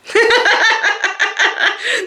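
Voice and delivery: a high, breathy burst of giggles; giggling at her own excitement. Real, not acted. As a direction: laugh out high and free. Bursts out laughing.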